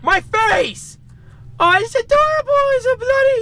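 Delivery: very high startled yelps; startled shriek. Real, not acted. Player startled by a monster, shrieking very high. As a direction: shriek at the jumpscare, then squeal.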